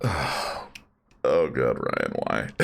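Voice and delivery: low, breathy exasperation; exasperated groan. Real, not acted. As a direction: groan it out in disbelief. Exasperated at chat guess.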